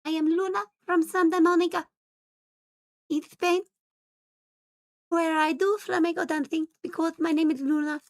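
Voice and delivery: a high, lilting Spanish cat introducing herself; Spanish flamenco dancer. Pretend. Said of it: "my Spanish flamenco dancer".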